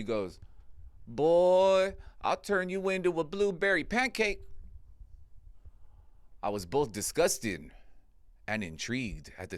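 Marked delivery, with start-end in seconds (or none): 1.1-4.4 put-on high crone barking "Boy" loud, then a sly threat; old lady voice. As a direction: hag promising to turn him into a pancake. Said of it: "old decrepit lady".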